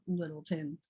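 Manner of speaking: "Littleton" low and soft, ending in -tən; local pronunciation. Shown as a demonstration. Proving they say it like that, "Littleton" with a reduced ending. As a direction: murmur "Littleton" with an unstressed "tun". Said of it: "They say it just like that".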